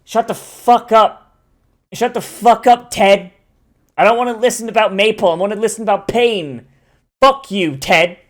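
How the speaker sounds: hammed-up angry shout at dev Ted; mock outburst. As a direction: snap at an imaginary colleague, half joking.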